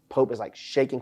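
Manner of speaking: ordinary talk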